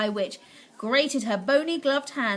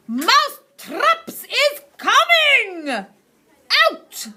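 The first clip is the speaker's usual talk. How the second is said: much higher, louder slow cackling shout; witch shout; 12 semitones higher, 10 dB louder, much slower than usual. Acted. Witch thrills her audience, cackling shout. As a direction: shriek it out in triumph. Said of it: "raised her voice louder than ever and shouted".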